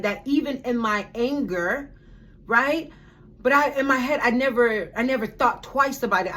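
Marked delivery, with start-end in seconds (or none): none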